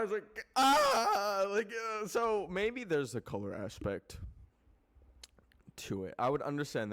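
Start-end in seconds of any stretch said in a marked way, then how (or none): 0.5-1.5 loud, high wail of panic; hammed-up frightened cry; mock scream. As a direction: let out a mock terrified "ah". Her retold reaction to being yelled at.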